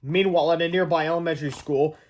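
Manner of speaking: usual speaking voice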